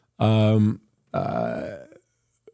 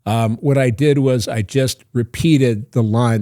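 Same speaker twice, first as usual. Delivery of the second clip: normal talking voice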